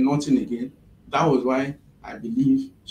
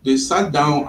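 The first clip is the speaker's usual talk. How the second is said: slower, firmer and more audible; speaking up; 6 dB louder than usual. Real, not acted. Interviewee repeating for a listener who missed it, speaking up. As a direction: speak up a little, spacing the words out. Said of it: "Can you speak up a little".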